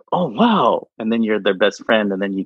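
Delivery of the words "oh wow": higher-pitched mock amazement; imitated "oh, wow" of delighted surprise; impressed reaction. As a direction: act out a wowed stranger's reaction. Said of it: "they go like".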